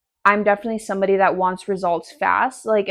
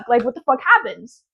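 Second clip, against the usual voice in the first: ordinary talk, nothing marked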